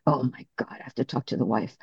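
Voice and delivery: a hushed, mock-horrified aside to herself; mock dread. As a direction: sink the voice and play it as comic dread. Her dreading reply to John.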